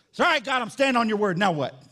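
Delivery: loud, cocky declaration to God; acted childhood defiance. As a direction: declare it loudly, as a kid challenging God. Acts out himself as defiant child.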